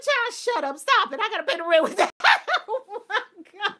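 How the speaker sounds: loud, high mom retort through laughter; laughing mock scolding. Mom shushing excited child over rent money, retold laughing. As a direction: scold while cracking up. Retells mom while cracking up.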